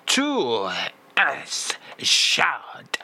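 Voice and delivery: tu es chaud breathed out in mock French; breathy French. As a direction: sigh tu es chaud in a French accent. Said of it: "it is a belter".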